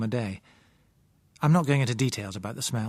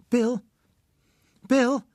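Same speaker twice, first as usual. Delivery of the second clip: high, louder call of a name; 12 semitones higher, 5 dB louder than usual. A narrator's reading. Sick girl upstairs calling Bill, high-pitched call. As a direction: call him from another room. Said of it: "a woman's voice called".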